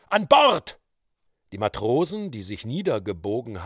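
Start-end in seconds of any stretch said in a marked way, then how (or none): 0.1-0.8 loud final roar; pirate chief roaring "an Bord"; thundering shout. A narrator's reading. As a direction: bellow the last command. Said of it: "donnernde Stimme".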